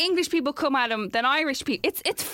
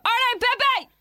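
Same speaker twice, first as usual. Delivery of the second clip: high, raised street heckle; shouted greeting; 11 semitones higher, 4 dB louder, slower than usual. Retold or imitated. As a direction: call out like a fan across the street. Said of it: "people just shout".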